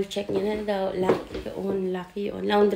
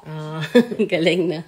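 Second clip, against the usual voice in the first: normal talking voice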